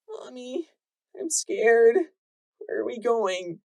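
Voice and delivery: put-on high, small frightened child voice; a frightened foal clinging to her mother; scared little filly. Little filly asking mother where they go, scared.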